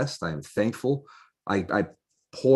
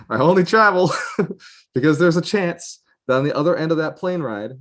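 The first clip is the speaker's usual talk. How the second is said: laughing; 8 semitones higher, 6 dB louder than usual. Real, not acted. Laughs about his travel.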